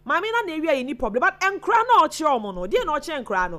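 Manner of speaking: fast, loud, high tirade; real heated rapid-fire scolding; indignant rant. Real, not acted. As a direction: rattle out the outrage. Host rants about stealing and children.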